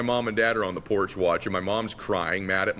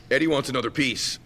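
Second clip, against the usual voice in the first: Big Ray; 5 semitones higher than usual. Retold or imitated. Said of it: "And he goes".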